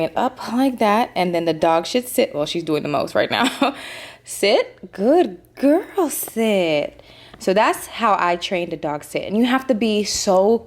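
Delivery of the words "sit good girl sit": praising a dog. Real, not acted. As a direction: praise the pup sweetly.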